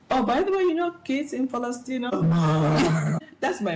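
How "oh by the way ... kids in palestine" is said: higher, sing-song teasing reminder; mock guilt trip. Mother teasing her crying kids about Palestine, sing-song guilt trip. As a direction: play the mom guilt-tripping the kids, a touch higher. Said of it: "I'm like".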